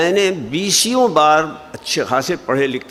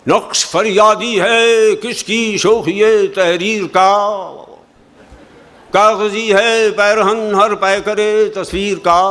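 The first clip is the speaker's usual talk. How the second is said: raised, slow, measured recitation of a ghazal; Urdu poetry recital; 8 semitones higher, 5 dB louder, slower than usual. Shown as a demonstration. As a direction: declaim each line to a gathering. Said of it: "कुछ शायरी पेश करूं आपकी खिदमत में".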